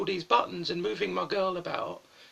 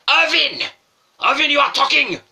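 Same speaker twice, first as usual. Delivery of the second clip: shouting teacher in a bad Japanese accent; mock Japanese-accented teacher yelling at a pupil; terrible Japanese accent; 10 semitones higher, 15 dB louder than usual. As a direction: bellow high and loud with a hammed-up Japanese accent. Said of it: "Forgive my extremely terrible accent".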